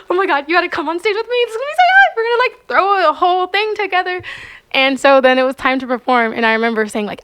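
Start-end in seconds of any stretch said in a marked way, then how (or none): 0.1-4.2 high, fast, breathless excitement; excited rush. Retold or imitated. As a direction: blurt it out thrilled and panicked. Excited plea to teammate.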